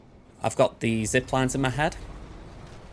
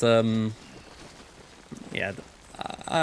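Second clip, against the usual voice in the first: ordinary delivery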